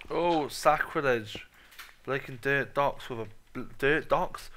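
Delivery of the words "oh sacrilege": mock outrage.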